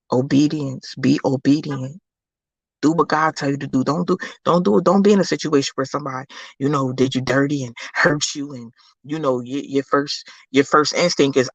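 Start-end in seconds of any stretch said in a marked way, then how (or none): none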